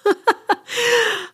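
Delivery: high bright burst of laughter; real laugh. Real, not acted. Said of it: "I started laughing".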